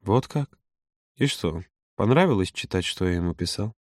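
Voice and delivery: cold, restrained questioning; icy tone. A narrator's reading. Юра confronting Володя about read messages, icy. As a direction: ask coldly, sinking low and soft at the end. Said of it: "произнёс ледяным тоном".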